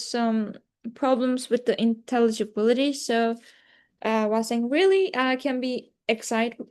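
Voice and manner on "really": high-pitched excited "really". Shown as a demonstration. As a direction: lift it right up with excitement. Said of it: "is excited".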